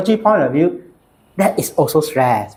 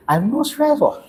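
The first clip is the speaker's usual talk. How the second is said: high mock denial; patient denying stress; 10 semitones higher than usual. Mimicking a patient in the clinic, high mock denial.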